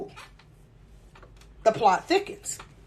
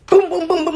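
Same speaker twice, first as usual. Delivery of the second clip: laughing knock noises; 3 semitones higher than usual. Retold or imitated. Imitates knocking while laughing.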